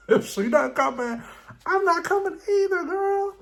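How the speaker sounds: mock her in a high squeal. Mocking Giselle's loyalty in a high squeal.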